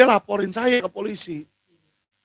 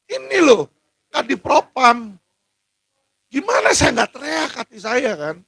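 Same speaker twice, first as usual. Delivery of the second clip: real exasperation; 4 semitones higher than usual. Real, not acted. Outrage over detainees beaten, threatened.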